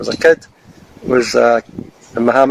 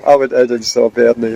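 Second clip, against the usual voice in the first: ordinary delivery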